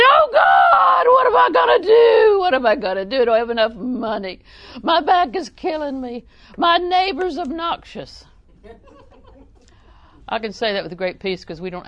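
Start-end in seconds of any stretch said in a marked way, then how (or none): 0.0-8.2 high, loud, hammed-up wail of distress; panicked complaining. Preacher mimicking believers who cry to God over troubles, panicked wailing. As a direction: wail it out like a mock desperate plea.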